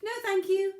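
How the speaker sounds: high, loud little pebble voice. Acted. Pebble calls out to the moose, high eager voice. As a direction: pitch it way up as the small, eager pebble. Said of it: "said the pebble".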